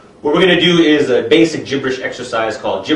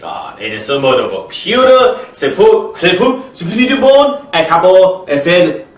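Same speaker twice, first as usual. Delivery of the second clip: higher, halting mock-foreign gibberish; stammering gibberish expert; 4 semitones higher, slower than usual. Gibberish expert sputtering about the soup, lightly voiced. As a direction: stumble over invented words. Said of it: "an example of gibberish expert translation".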